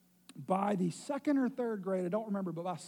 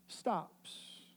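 ordinary delivery

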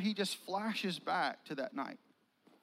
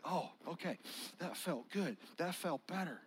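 relieved murmur; 6 dB quieter than usual. Acted. Peter relieved.

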